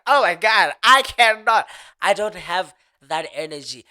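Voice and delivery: hammed-up exasperation, easing off at the end; exasperated protest. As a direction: protest loudly, as if pushed past your limit. Protesting dad's late bedtime, retold.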